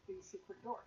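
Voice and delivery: ordinary talk, nothing marked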